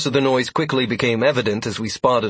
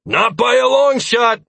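slow loud defiant yell; 13 semitones higher, 6 dB louder, slower than usual. A narrator's reading. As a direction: yell it while slicing through. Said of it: "I yelled".

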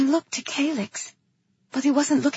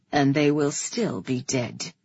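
low, restrained reply; grim brother; 8 semitones lower than usual. A narrator's reading. Brother countering the king's threat, grim. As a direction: answer low and grimly.

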